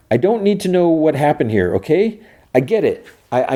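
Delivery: high, urgent appeal with halting starts; earnest imitated begging; pleading. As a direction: plead through the door, pitch held up. Pleading with Solo to help.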